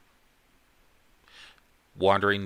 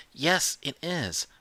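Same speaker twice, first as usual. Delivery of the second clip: much higher, slow stubborn insistence; insistent child; 10 semitones higher, slower than usual. Acted. Liam stubbornly insisting to the counselor, high and slow. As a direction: dig in and insist like a stubborn kid.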